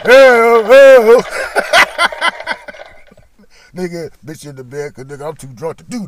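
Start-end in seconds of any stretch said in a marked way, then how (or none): 0.0-1.2 loud high yelled hell; loud whooping shout. Retold or imitated. Outburst amid laughter. 1.3-3.7 loud high wheeze laugh; high wheezing laugh. Real, not acted. Laugh after shout.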